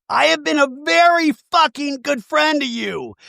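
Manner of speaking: high, emphatic imitation of outrage; mock indignant protest. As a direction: protest it hammed-up and pitched high. Retold shouting match.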